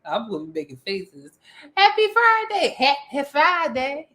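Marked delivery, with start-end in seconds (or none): 1.8-4.1 high, slow mock greeting; goofy sing-song "Happy Friday"; silly greeting. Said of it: "No, that's not how you".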